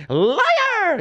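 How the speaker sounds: high, drawn-out cry of accusation; hammed-up high-pitched shriek from a comic witch; mock movie screech. Quoting a Princess Bride scene, high screechy voice. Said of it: "Very Princess Bride".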